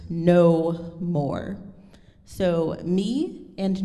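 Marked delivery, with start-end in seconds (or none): 0.1-1.6 loud, weighty stress on "no" and "more"; both words "no more" stressed and separated; accented no more. Shown as a demonstration. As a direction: punch "no", then hold "more". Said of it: "these are on the words".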